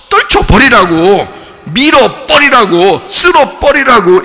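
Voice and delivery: loud, high, drawn-out commands; emphatic shouted insistence; forceful rejection. Acted. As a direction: hurl each command away slowly.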